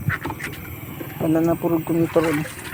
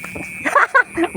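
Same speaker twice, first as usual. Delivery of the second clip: high laugh. Real, not acted. Laughs at drowning joke.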